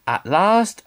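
ordinary delivery, nothing marked

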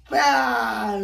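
loud hammed-up cry of "Bad"; mock disgusted shout. Shown as a demonstration. Leading kids in a thumbs down, mock shout. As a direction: bellow it like you just ate something horrible. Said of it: "bad look face".

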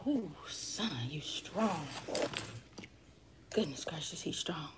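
soft amazed whisper. Real, not acted. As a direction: whisper it in awe. Baby is nearby, whispering.

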